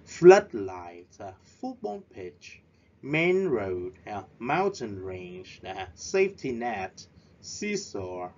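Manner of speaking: "Floodlights", "football pitch", "main road" and each following compound stressed on its first part; stress on first word. Shown as a demonstration. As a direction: push the voice up on the first word of each compound, as in FOOTBALL pitch, TENNIS court, TOWER block, then drop. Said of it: "chúng ta nhấn ở cái từ đầu tiên".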